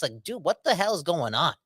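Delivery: ordinary talk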